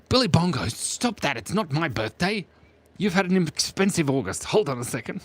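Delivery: high-pitched playful protest; mock-outraged and high; mock scolding. Mock scolding a donor's gift.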